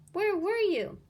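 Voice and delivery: high, loud urgent question; eager kids. Acted. Kids asking their teacher, excited. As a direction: burst with curiosity.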